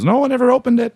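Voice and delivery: put-on a sly denial in East Coast accent. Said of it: "she told people for years".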